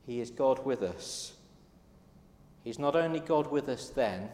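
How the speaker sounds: ordinary talk, nothing marked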